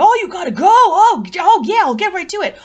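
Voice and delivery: high, loud, flustered bumbler; bumbling plumber. Retold or imitated. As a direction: blurt it out like an eager, bumbling klutz. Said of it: "like, so bumbly".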